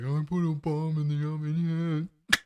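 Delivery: mock a dim teenager making a prank call; dumb teenage kid. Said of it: "some dumb teenage kid's like".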